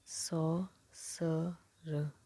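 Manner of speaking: very slow, drawn-out saucer; teacher drags out saucer slowly; slow demo word. Shown as a demonstration. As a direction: stretch saucer out for the class. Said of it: "ये सीज बन जाएगा saucer".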